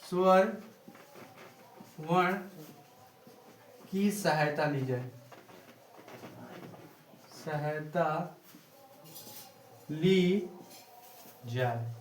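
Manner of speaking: soft, word by word with long pauses; measured, low-key dictation with gaps; slow dictation. Shown as a demonstration. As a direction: dictate at writing speed.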